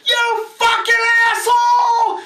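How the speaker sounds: high, very loud, slow furious shriek; the old man loses it completely; old man screaming. Acted. Old juror third outburst.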